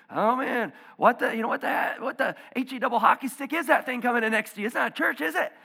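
imitating a skeptical friend at the mall, loud and high. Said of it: "he said".

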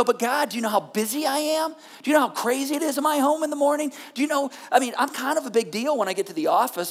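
mock-important whine at God; whiny complaint to God. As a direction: plead your busyness, full of yourself.

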